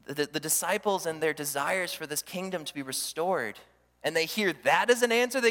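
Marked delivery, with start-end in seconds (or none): none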